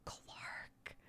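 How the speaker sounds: hushed whisper of a name. Retold or imitated. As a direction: whisper so a sleeping child isn't woken. Mom whispering to sleeping kid.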